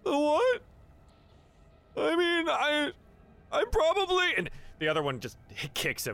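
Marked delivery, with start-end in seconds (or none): 0.0-4.3 very high, squeaky character voice; timid hapless captive. Acted. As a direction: stammer hesitantly in a tiny high voice. Game master answers as hapless Kirkendall.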